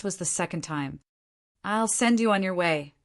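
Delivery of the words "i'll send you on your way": ordinary delivery, nothing marked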